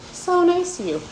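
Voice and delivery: "So nice to you" lifted high and pressed; stress on So nice to you. Shown as a demonstration. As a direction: sing out "So nice to you" with emphasis. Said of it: "So step one is to be so nice to you".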